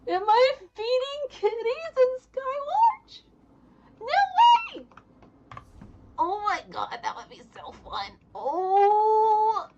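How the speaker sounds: very high, loud, delighted squeal; real joy, voice shooting up; squealing excitement. Real, not acted. Thrilled at a cat-feeding quest.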